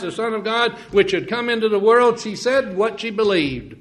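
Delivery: high, loud preaching cadence; rising revival sermon voice; fervent preaching. Acted. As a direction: lift it into full fervent preaching. Preaching at full force.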